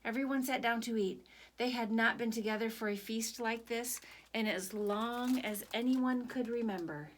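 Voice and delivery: usual speaking voice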